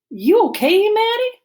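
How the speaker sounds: concerned question. A narrator's reading. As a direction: call out to check on a friend. Worried friend calls out to Maddie.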